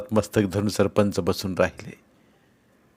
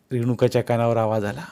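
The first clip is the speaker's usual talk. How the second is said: usual speaking voice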